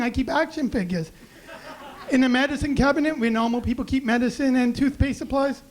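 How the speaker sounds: ordinary delivery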